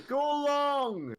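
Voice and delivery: high, drawn-out yell; shouted call. Acted. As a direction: holler it across a field to a receiver. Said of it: "And I shout".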